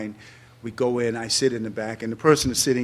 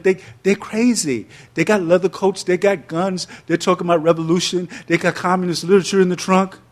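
higher and louder, thrilled about the guns and coats; thrilled teen; 7 semitones higher, 7 dB louder than usual. Retold or imitated. Teen gushing to himself about joining the Panthers, excited and louder. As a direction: gush like a fifteen-year-old who wants in. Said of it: "I was like".

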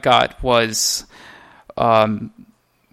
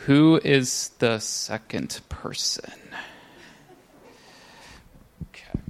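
whispering; 5 dB quieter than usual. Real, not acted. Side question to a helper.

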